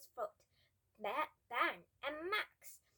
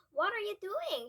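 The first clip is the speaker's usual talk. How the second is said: loud, high demanding call; shout; 9 semitones higher, 10 dB louder than usual. Acted. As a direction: shout after the boys running off. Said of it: "shouted Rosie".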